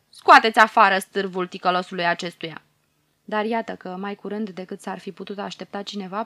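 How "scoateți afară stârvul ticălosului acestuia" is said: loud, scornful order; thundering command. Acted. Pharaoh orders the guards to drag out the body, thundering. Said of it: "poruncește cu glas de tunet".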